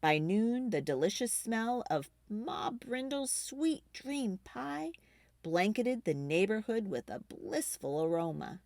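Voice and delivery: usual speaking voice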